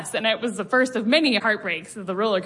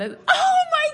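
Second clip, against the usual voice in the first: a shrill-free, much higher laughing shriek of "Oh my gosh!"; mock scandalized yelp, pitched way up; scandalized scream; much slower than usual. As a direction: shriek it, half laughing, as the joke lands. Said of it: "And I screamed".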